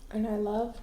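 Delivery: talking through a mouthful; words round a bite of food; mouth full. Real, not acted. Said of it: "talking about my mouth full".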